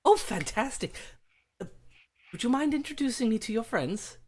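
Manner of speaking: high, delighted British dad; British father eager to meet friends; British accent. Acted. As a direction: gush politely in a British accent. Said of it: "Daddy's British".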